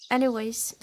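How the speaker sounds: ordinary talk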